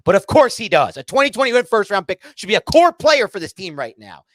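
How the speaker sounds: real anger flaring up again; getting mad. Real, not acted. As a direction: snap back, really heated. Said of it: "And I'm getting mad just thinking".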